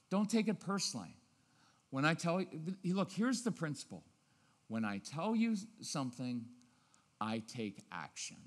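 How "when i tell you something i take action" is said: low, soft and steady; a settled parent voice; calm firm tone. Shown as a demonstration. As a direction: lay down the rule quietly and evenly. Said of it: "Watch, the tone of voices".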